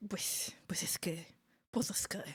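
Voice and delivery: hushed imitation of mom's Spaniard accent. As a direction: softly mimic mom faking a Spaniard accent. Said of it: "imitate my mom doing a Spaniard accent".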